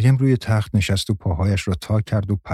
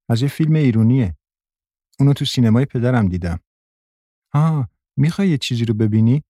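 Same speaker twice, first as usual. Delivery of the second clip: put-on higher-pitched little girl's chatter; eight-year-old girl, eager; 4 semitones higher than usual. A narrator's reading. Girl telling Maryam about a film, eager child voice.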